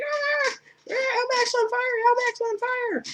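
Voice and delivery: very high hammed-up shriek of panic; hammed-up panic scream. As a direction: scream it in mock terror. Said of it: "she's like".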